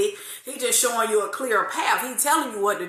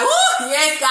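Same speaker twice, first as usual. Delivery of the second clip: excited yes; 5 semitones higher, 6 dB louder than usual. Retold or imitated. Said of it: "I was like".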